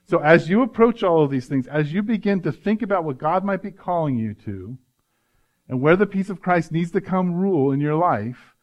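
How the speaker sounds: ordinary delivery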